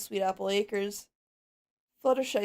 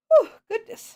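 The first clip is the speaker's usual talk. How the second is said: startled gasp; 13 semitones higher, 12 dB louder than usual. Acted. Startled after yawn.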